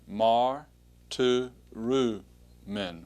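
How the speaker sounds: "Mar, tu, ru, men" in separated, drawn-out syllables; syllable-by-syllable pronunciation. Shown as a demonstration. Teacher says the word for students to repeat, syllable by syllable. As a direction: say "Mar, tu, ru, men" piece by piece, falling at the end. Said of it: "let's say it again".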